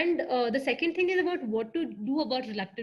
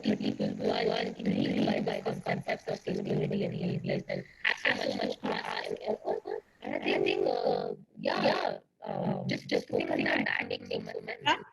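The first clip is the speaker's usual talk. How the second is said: metallic, glitchy robot voice; distorted like a breaking mic; robotic voice. Real, not acted. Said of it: "You sound robotic".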